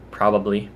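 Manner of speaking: "probably" in all three syllables; unrushed full form. Shown as a demonstration. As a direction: say "probably" fully, prob-ub-lee. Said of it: "if I'm not really in a rush".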